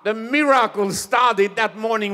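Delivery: loud, high, jubilant preaching. Acted. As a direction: proclaim the miracle triumphantly. Preacher rising to full force.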